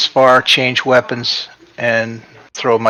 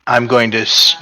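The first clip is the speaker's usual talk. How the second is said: ordinary talk, nothing marked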